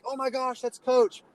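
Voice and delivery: high, gushing squeal of recognition; excited girls. Retold or imitated. Girls at a selfie spotting their coach, excited squeal. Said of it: "all of them were like".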